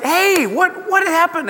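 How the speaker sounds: high, stunned question; startled. Retold or imitated. Jacob asking Laban what happened, high and startled. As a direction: Jacob waking up confused. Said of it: "And he's like".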